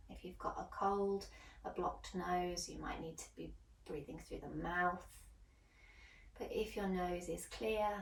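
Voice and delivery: soft, calm yoga guide's voice; hushed relaxation guidance, letting phrases trail into near-whisper; guided meditation. Acted. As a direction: settle them gently into the breath, softly, with long pauses. Leads breathing and settling in.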